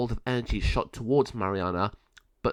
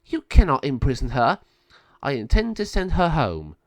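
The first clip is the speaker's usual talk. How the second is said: louder, firm angry warning; cross; 3 semitones higher, 6 dB louder than usual. Acted. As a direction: stand your ground, voice raised in anger. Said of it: "Sabio became very cross".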